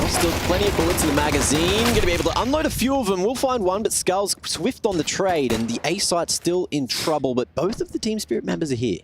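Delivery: hyped esports caster; excited play-by-play. Real, not acted. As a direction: race through the action call. Caster hyping a live fight.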